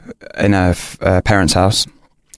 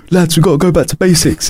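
higher rallying captain voice; football captain; 9 semitones higher than usual. Retold or imitated. Captain telling his losing team to regroup, raised rallying voice. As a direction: play the skipper geeing up the lads.

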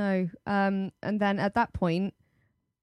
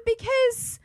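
very high-pitched indignant protest; hammed-up outrage held high; retold anger. Player challenging him over teammate's hair, high mock anger. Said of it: "I'm angry".

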